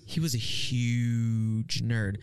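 "huge" stretched very long and low; stress on huge. Real, not acted. Teasing an old schoolmate, "huge" hugely drawn out. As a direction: drag out "huge" slowly for comic weight. Insisting on how nerdy he was.

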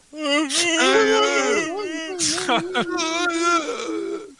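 put-on loud, high-pitched baby seal cries; long high animal yelp, imitated; mock seal wail. Mimics a beaten baby seal.

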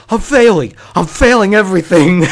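loud, high shout of despair; frantic howl of a failing student; retold shout. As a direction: yell it out like you're failing everything. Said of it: "and he shouts".

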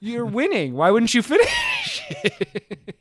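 high incredulous whine, slowing at the end; mock exasperation. Viewer pleading with winning fighter to finish it, exasperated high voice. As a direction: plead it in comic disbelief, stretching out the end.

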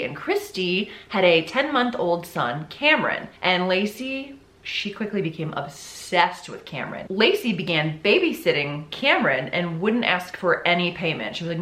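ordinary delivery, nothing marked

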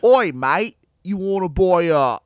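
put-on hammy Australian accent. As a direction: loose Australian bloke voice. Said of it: "That's my Bill Dundee".

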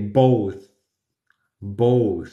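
both ending in a light th; soft th. Shown as a demonstration. Teacher modelling both. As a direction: end both on a gentle th. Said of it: "Sin sobreactuar".

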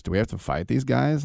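ordinary talk, nothing marked

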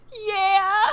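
high laugh-cry yeah; half-sobbing laughing yeah; teary laughing yes. Retold or imitated. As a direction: admit the crying through laughter. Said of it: "And I was like".

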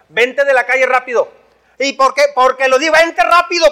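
rising to a high, loud call; urgent parent call. Retold or imitated. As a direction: hurry the kid in off the street. Parent calling child off street.

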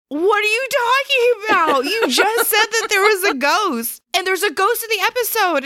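high, laughing, exasperated retelling; laughing disbelief. Retold or imitated. Coworkers insisting Linda said ghost, high laughing disbelief. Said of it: "him and I were like".

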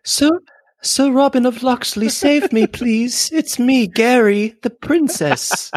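very high, pleading cartoon princess; mock damsel princess. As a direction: beg Sir Robin to save you like a swooning princess. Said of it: "doing an impression of me".

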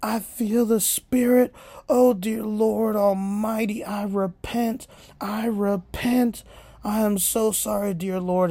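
high, loud, hammed-up cry for forgiveness; frantic repenting. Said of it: "all of a sudden he goes".

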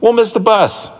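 urgent. Acted. Urgent warning about bus.

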